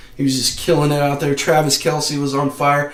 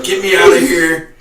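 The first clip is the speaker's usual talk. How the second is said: imitating a scared ref; 4 semitones higher, 10 dB louder than usual. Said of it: "that dude sounded scared".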